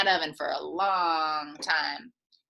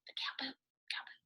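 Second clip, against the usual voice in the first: whisper; 3 semitones higher, 18 dB quieter, much faster than usual. Acted. Aside whisper to kids about cow poop.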